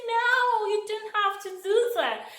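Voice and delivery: put-on high American-accented voice, the host insisting; American accent, high and insistent; American accent imitation. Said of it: "what she was speaking in her accent".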